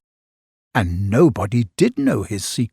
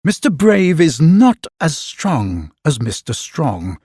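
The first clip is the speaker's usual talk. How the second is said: plain reading voice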